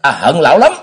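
high, loud outburst of hatred; shouted. A narrator's reading. Son tells his father he hates him, shouting. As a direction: burst out and yell it at him. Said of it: "Tam Đường la lên".

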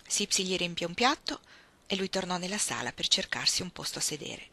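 ordinary delivery

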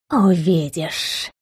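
slow, soft snake-like hiss. A narrator's reading. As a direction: hiss it like a serpent. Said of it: "прошипела она, будто змея".

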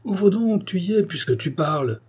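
high-pitched, impatient call; irritated shout. A narrator's reading. Visitor shaking a locked door at his girlfriend, irritated shout. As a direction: shout crossly to be let in. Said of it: "criant d'un ton irrité".

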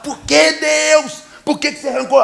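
loud high mock outrage at heaven; angry cry to God. Neighbor shouts at God over his fallen tree, angry and loud. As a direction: shout it up at the sky, hurt and indignant. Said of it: "Aí ele ficou muito bravo".

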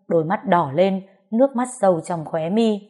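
ordinary delivery

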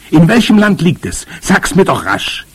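high, loud, pressing questions; impatient. A narrator's reading. Boy begs Großmama for the country, impatient and urgent. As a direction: push impatiently for the answer. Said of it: "rief ich ungeduldig".

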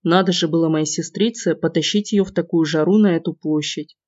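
plain reading voice

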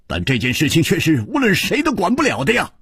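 quick, strained insistence; through gritted teeth. A narrator's reading. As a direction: force it out through clenched teeth. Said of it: "一点红咬了咬牙说".

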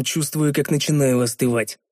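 plain reading voice

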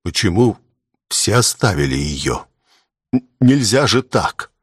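strangled, halting reproach; choked voice. A narrator's reading. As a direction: force the words out through a tight throat. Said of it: "проговорил сдавленным голосом".

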